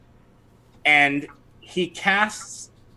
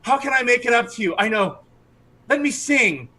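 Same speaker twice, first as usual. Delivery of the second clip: high, swooning, pleading voice; charmed archer begging forgiveness, high and swooning; lovestruck archer; 8 semitones higher than usual. Acted. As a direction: gush the apology, smitten and breathless. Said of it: "he says".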